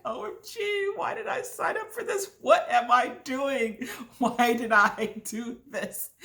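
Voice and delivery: high squeaky dread breaking into laughs; hammed-up panicked wail, slowing down; retold panic. Skydiver watching others roll out, comic panic.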